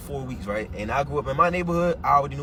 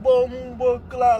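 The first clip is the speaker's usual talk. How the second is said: higher, louder mock sound effects of a slap; expecting a beating; 9 semitones higher, 5 dB louder, much slower than usual. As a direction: gleefully act out the hit coming. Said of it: "I said".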